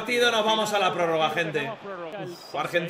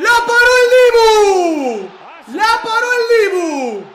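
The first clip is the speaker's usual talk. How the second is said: screaming with joy, much higher; ecstatic shout; 10 semitones higher, 10 dB louder, slower than usual. Real, not acted. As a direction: yell it, half laughing. Goalkeeper saves the penalty.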